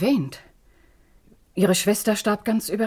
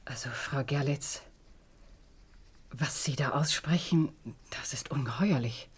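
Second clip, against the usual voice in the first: hushed, softer, breathless disbelief; shocked whisper; 2 semitones lower, 7 dB quieter than usual. Acted. Ex-wife hears murder accusation, whispering in shock. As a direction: whisper it as if afraid to be overheard.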